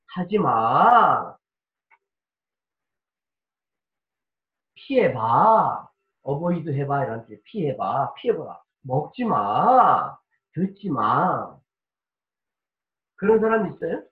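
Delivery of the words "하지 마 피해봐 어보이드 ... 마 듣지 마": mock gentle warning, low, soft and slow; soft, slow, easygoing. Pastor mocking soft-spoken parents, slow and gentle. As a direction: drawl it lazily like someone who does not care. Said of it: "편한 말로 천천히 느긋하게".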